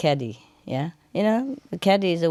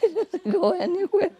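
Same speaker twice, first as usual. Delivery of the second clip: giggling through the words; laughing; 13 semitones higher than usual. Real, not acted. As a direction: laugh it out, high. Laughing at own punchline.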